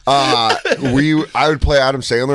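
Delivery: ordinary delivery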